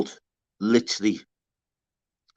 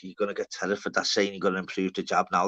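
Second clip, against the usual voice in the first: quiet mock teasing; 5 semitones lower, 7 dB quieter than usual. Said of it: "I'm like".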